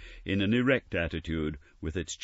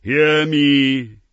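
much louder and higher call; loud ghostly command; commanding cry; 11 semitones higher, 15 dB louder than usual. Acted. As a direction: demand to be heard. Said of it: "cried the ghost".

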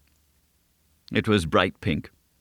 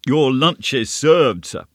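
slow, raised call across the room; 6 semitones higher, 4 dB louder, slower than usual. A narrator's reading. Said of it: "called rather than said to him".

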